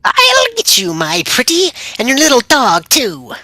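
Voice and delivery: high cackling witch threat; wicked witch voice. Pretend. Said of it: "I'll let you do it again".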